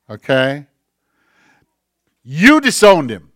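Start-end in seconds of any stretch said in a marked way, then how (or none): 2.2-3.3 "You" punched louder, pointing at the crowd; accusing stress on you. Shown as a demonstration. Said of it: "how hard this finger poked into the chest".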